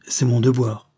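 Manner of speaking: firm, mildly emphatic; energetic. A narrator's reading. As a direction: state it with resolve. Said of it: "reprit-elle avec énergie". (avec une énergie résolue)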